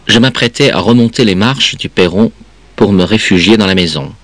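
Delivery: ordinary delivery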